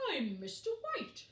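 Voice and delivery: a hint of a big friendly bear; gently voiced bear introducing himself; lightly voiced polar bear. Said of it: "he said".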